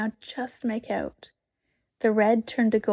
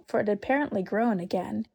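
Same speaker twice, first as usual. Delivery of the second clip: plain reading voice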